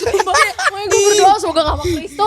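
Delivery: laughing. Real, not acted. Laughing over cringe clip.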